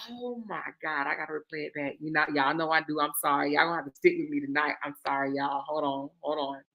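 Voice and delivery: soft, low apology to chat; quiet aside. Real, not acted. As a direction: murmur it off to the side.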